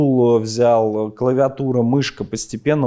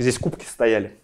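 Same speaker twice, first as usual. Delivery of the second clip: ordinary talk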